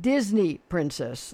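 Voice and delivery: slow, deliberate enunciation; "Disney princess" drawn out word by word; clarifying repeat. Real, not acted. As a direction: spell out "Disney princess" slowly for someone who misheard. Host clarifies for caller who misheard.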